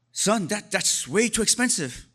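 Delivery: lightly voiced haggling mother; imitating mom. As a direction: play his Korean mom scoffing at the price.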